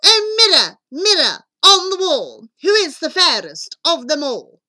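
loud, high imperious call; demanding shout, each phrase swooping down from high; spoiled queen shouting. A narrator's reading. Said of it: "spoiled and stupid queen would shout".

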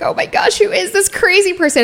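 whispered gasp rising to a high alarmed cry; clutching purses in panic; panicked. Retold or imitated. Bridesmaids panicking.

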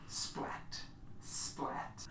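soft, voiceless splats; wet footstep sound. Acted. Game master imitates steps on sticky flesh, soft splats. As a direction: imitate squelching steps under the breath.

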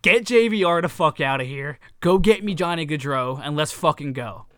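rally the room as the player; fired-up player. Retold or imitated. Voicing the player demanding help, loud and fired-up. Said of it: "turned around and was like".

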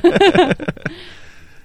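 high, loud laugh; loud amused cackling; real laugh. Real, not acted. Laughing at the prank clip.

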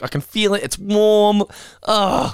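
high-pitched mock disgust; grossed out. Picking up dog shit with a bag, disgusted. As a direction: act squirming revulsion, pitch way up.

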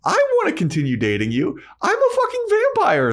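very high, loud mock outburst; hammed-up vampire boyfriend losing it; fed-up Angel. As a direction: blurt it out in squeaky frustration. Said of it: "Angel gets fed up".

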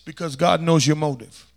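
ordinary delivery

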